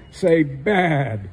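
ordinary delivery

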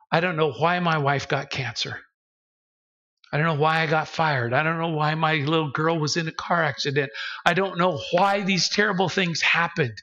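subdued, heartfelt confiding in Jesus; sincere humble prayer. Acted. Suffering believer thanking Jesus as a friend, humbly sincere. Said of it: "come to God and say".

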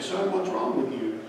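soft, slow, tender question; quiet slow reassurance; gentle concern. Retold or imitated. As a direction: ease down to a hurting child. Preacher to crying boy.

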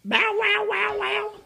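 high, loud playful barking; dog bark. Acted. Imitating a dog for toddler.

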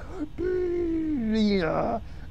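high, drawn-out rising squeal; soft high comic squeak; imitated noise. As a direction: squeeze out a thin high noise. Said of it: "and it's like".

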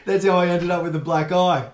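said through a laugh. Real, not acted. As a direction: chuckle out the tag line. Laughs at own joke.

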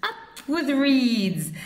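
high, slow hooting cheer; high hoot, drawn out. A narrator's reading. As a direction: stretch the hoot out slowly. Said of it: "they hooted".

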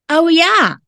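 usual speaking voice

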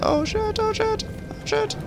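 tiny soft falsetto squeal; mock panic. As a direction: fret in a very high, small voice. Mock panic during game.

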